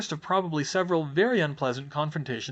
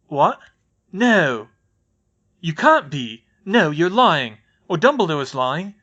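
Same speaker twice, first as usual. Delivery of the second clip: loud, raging denial; angry disbelief; 3 semitones higher, 10 dB louder, slower than usual. Acted. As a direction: shout it down like it can't be true. Brothers react to shocking news.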